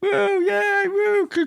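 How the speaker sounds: very high squealed woo, yay; hammed-up falsetto cheer; mock crowd cheering. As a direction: shriek it like a giddy crowd. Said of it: "everybody's going".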